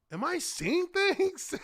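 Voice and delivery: high, slow mock astonishment; mock disbelief. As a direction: voice a stunned man, hammed up and high. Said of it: "Pulls his glasses off".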